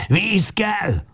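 high cry for water; hoarse shout. A narrator's reading. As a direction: cry out desperately. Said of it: "kiáltja rekedten". (rekedt kiáltás)

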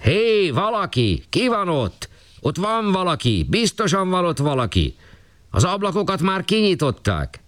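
high, urgent voices calling up from below; calling from the street. Acted. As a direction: shout up at the windows, sure someone is inside. People shouting from the street.

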